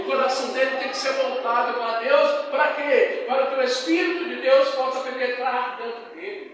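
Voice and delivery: loud, building exhortation; preaching climax. Real, not acted. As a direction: drive it home to the congregation.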